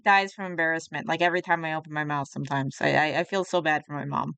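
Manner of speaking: ordinary delivery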